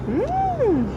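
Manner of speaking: high hum. Shown as a demonstration. Modelling elephant sound for toddler.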